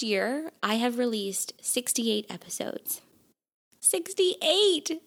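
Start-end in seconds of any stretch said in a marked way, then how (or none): 3.8-5.0 excited. Real, not acted. Amazed at own episode count.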